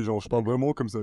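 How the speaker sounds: low, odd put-on stage voice; exaggerated stress voice, slightly low. Shown as a demonstration. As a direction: demonstrate your voice changed by nerves. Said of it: "ma voix, elle change".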